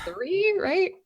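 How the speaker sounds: high uncertain check; questioning. Real, not acted. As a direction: ask it, unsure of the math. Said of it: "checking the math, unsure".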